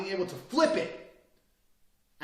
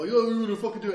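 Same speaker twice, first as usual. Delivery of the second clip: thug challenge; 6 semitones higher, 7 dB louder, faster than usual. Retold or imitated. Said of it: "they go".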